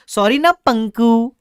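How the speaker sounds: slow, high cutesy plea; cutesy pleading. Retold or imitated. Customer cutely coaxing the shopkeeper, slow and sweet. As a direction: coax him sweetly, drawn out. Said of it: "ऐसा बोला मैंने".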